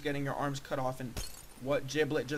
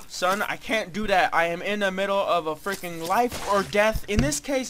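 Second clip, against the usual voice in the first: loud rant climbing higher to the end; exasperated dad; 4 semitones higher, 7 dB louder than usual. Acted. Dad refusing son's homework mid-game, loud exasperated rant. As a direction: build louder and higher in exasperation.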